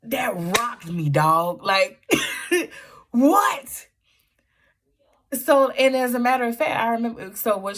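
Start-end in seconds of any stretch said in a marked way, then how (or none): 3.1-3.9 laughing gasp. Real, not acted. Overwhelmed by the language.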